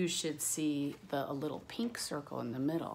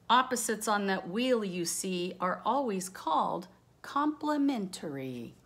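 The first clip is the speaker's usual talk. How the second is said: louder, lilting read-aloud of rhyming verse; reading rhyme aloud; 4 semitones higher, 6 dB louder than usual. Acted. Teacher reading a rhyming color book to the class, sing-song. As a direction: bounce through the rhyme like a storybook.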